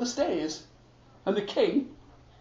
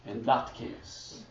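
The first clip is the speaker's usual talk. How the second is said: mock menace; 11 semitones lower, 10 dB quieter than usual. The king quietly threatens to behead Niels, low and hammy. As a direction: play a hammy villain.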